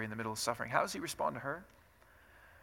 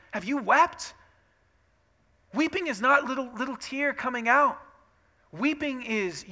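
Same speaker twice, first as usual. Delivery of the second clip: loud, high, emphatic sermon delivery; impassioned preaching; 6 semitones higher, 8 dB louder than usual. Real, not acted. Preacher telling the congregation what weeping is, impassioned. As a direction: drive the point home at full force.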